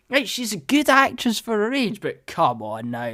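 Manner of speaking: usual speaking voice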